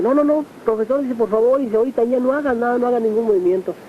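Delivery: high, urgent pleading to stay still; alarmed doctor. Retold or imitated. Doctor urgently stopping the heart patient from getting up, high alarmed plea.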